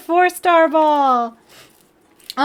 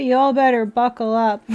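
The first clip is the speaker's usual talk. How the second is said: ordinary talk, nothing marked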